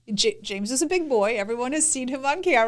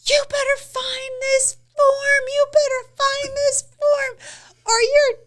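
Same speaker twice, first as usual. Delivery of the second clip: high-pitched, drawn-out mock scream; 12 semitones higher, slower than usual. Wife imitates her sleepless husband threatening her, screaming. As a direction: shriek the threat slowly, a hammed-up impression. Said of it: "He starts screaming at the top of his lungs".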